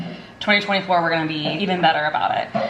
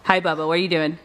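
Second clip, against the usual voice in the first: ordinary delivery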